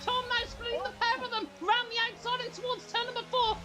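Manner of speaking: high, excited race call, voice cracking; voice cracking excitement. Real, not acted. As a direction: yell the overtake, pitch way up. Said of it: "my big voice cracks in that ending".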